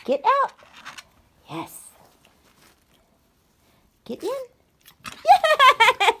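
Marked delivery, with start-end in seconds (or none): 4.3-6.1 laughing. Real, not acted. Laughing praise.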